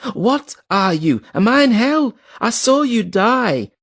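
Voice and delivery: high, slow, panicked cries; terrified screaming. A narrator's reading. Hedrigal screaming at ghosts he saw die, terrified. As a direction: scream out in horror. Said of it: "he screamed suddenly".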